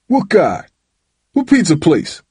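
high, angry challenge; thundering. A narrator's reading. Father demands details of son's alibi, thundering. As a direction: thunder back at his excuse. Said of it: "Dad thundered".

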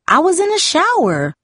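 a lightly voiced yell from the teen sister. A narrator's reading. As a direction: call it out high, as if from another room. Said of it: "Jamie yelled".

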